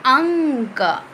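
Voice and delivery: अंक drawn out slowly, the nasal ं held through the nose; slow nasal anusvara. Shown as a demonstration. Teacher models a word for her pupils, slow and nasal. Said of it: "क्या लिखा है?".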